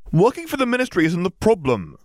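sharp, rising sibling argument; heated. Acted. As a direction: snap back at your brother. Ginny argues back.

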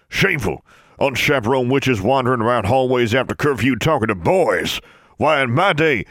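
high, loud scandalised rant; outraged old wizard. Acted. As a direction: splutter with outrage.

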